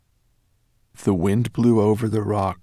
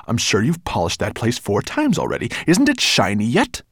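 impatient, raised-voice grumble; Nettlebrand grumbling; 3 semitones higher, 6 dB louder than usual. A narrator's reading. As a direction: push the servant with a loud, rising question. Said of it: "grumbled Nettlebrand".

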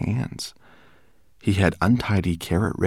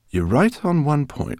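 a lightly voiced gentle professor; calmly correcting his colleague; lightly voiced calm scholar; Greenbloom; 10 semitones higher, 5 dB louder than usual. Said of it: "said Professor Greenbloom".

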